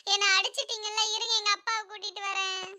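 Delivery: put-on soft, whimpering little-boy voice; hurt schoolboy. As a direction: threaten quietly like a wronged child. Slapped pupil threatens teacher. (அடிவாங்கிய சிறுவனின் மெல்லிய குரல்)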